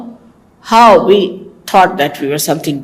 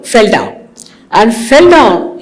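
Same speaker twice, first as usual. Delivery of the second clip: ordinary talk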